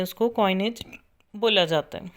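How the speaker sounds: ordinary talk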